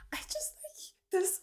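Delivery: whispered trailing off. Real, not acted. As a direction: drop to a whisper. Whispered aside.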